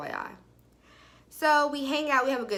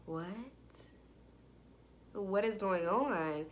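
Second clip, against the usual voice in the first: slow, low-key bafflement; stunned disbelief; 3 semitones lower, 10 dB quieter, slower than usual. Retold or imitated. Storyteller reliving her discovery of his false name, stunned. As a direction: let it sink in slowly.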